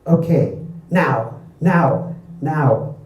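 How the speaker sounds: punchy, lightly barked orders; barked command. A narrator's reading. Mock in the boat urging his crew, lightly barked. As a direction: bark it in separate bursts. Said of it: "Mock barked".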